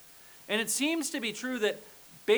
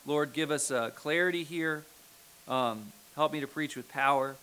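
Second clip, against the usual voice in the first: low-pitched, even praying voice; prayer; 5 semitones lower than usual. Real, not acted. Preacher asking God for help preaching, in lowered prayer voice. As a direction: pray aloud, subdued and earnest.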